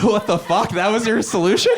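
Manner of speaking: high-pitched comic disbelief; incredulous outburst. Acted. Incredulous at the town's plan.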